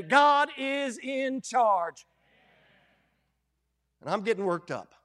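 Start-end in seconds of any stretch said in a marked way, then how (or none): 0.0-2.1 each word slowly weighted; slow emphatic. Real, not acted. Preacher driving home the main point, slowly. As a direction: land it slowly and firmly. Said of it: "remember this if you don't get anything else".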